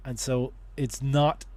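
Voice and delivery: ordinary talk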